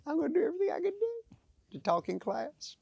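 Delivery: put-on high, sing-song rebellious kid; a high mock-defiant schoolkid. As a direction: play the cheeky kid who won't listen.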